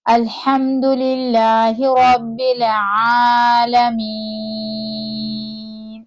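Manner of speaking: slow, drawn-out melodic tajweed recitation; Quran recitation. Shown as a demonstration. Teacher reciting Surah Fatiha for students to follow, slow melodic chant. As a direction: chant each verse slowly with long held vowels.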